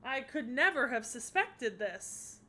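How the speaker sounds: loud, high dramatic gasping words; theatrical shock. Acted. Onlooker reacts to the goddess's true form, loud high theatrical shock. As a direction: overplay the astonishment. Feigned or staged shock at reveal.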